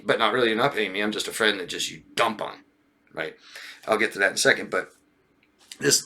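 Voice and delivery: ordinary talk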